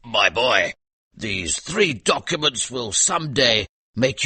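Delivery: a hint of a drunken father lecturing his boy; lightly voiced tipsy soldier father. Drunk father promising his son a future, lightly voiced. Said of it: "he often said to Kim".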